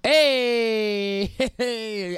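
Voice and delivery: high, loud, drawn-out hey; celebratory cheer. Real, not acted. Host celebrating classes nearly finished, excited cheer. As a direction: cheer it out, with a laugh.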